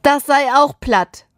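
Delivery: high, whiny near-wail; nearly wailing. A narrator's reading. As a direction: report it as if about to cry. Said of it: "heult er fast".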